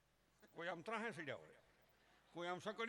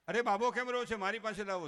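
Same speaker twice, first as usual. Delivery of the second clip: lightly voiced grandfather, louder and higher; 4 semitones higher, 9 dB louder than usual. Grandfather asking for the crying baby, lightly voiced, loud. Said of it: "grandfather".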